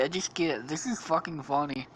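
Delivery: usual speaking voice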